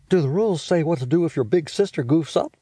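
high, quick bossy big-sister voice; a know-it-all sister teasing her brother; Judy's voice. Acted. Big sister scolding little brother about smell, high and bossy. Judy speaking in story.

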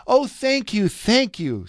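high gush of gratitude, then easing down; delighted thanks. A narrator's reading. Princess thanks the jay for her new voice, delighted. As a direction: start high with grateful delight.

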